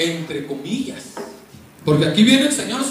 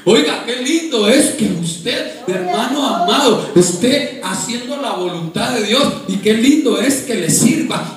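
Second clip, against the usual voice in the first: loud, high, impassioned pulpit delivery; full-force preaching; 6 semitones higher, 5 dB louder than usual. Real, not acted. Preacher urging the congregation to serve God, impassioned preaching. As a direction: build to a drawn-out, crying-out climax.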